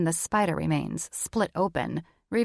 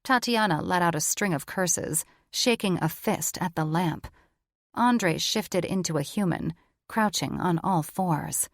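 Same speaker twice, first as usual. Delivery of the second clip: ordinary delivery, nothing marked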